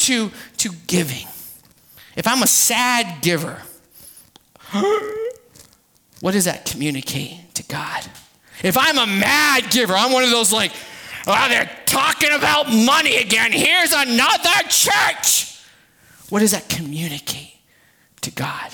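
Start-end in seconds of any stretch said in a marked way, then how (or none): 4.7-5.3 mock gasp. Mock reaction to money talk. 11.3-15.6 mock grumbling. Mocking a grumbling churchgoer.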